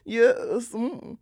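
high, affected British cooing; exaggerated British parody, pitched high; mock British accent. Shown as a demonstration. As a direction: ham up a silly British accent. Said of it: "That's my British accent".

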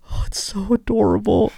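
high-pitched gushy cooing; put-on high, melting voice; gushing coo. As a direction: coo over something unbearably cute. Voicing his tearful reaction to video.

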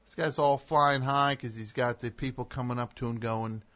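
usual speaking voice

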